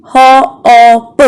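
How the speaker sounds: "Hop" stretched into separate sounds; sounded out. Shown as a demonstration. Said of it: "We can only hear a one vowel sound".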